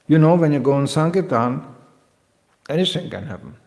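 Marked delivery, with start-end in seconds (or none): none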